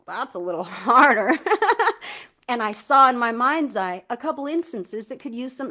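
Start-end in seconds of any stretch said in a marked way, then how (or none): 0.1-2.5 laughing it off at the end; a rueful laugh through the words; real amused laugh. Real, not acted. Admitting forgiveness is hard.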